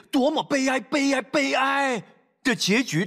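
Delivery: drawn-out wail of sorrow, very slow; slow and wailing over the tragedy; mournful lament. Real, not acted. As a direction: cry out the grief slowly. Lament over tragic ending.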